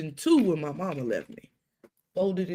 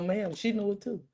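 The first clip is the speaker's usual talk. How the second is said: usual speaking voice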